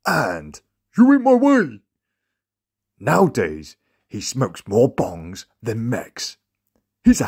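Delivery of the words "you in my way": much higher and louder shrieked falsetto; mocking girly voice. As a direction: squeal it at him as Sally. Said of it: "His favourite sayings are".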